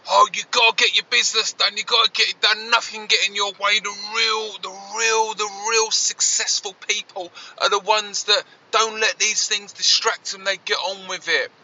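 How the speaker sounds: a nagging inner voice urging him on; pushy inner voice. Retold or imitated. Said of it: "the first part that you hear in your head".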